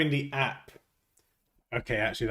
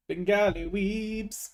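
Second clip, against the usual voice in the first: goofy voice; 10 semitones higher, much slower than usual. Pretend. Mock greeting to viewers.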